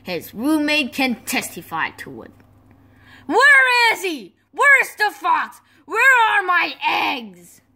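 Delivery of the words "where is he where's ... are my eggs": loud, high furious demand; shout, demanding answers; angry shouting. Acted. Furious search for stolen eggs.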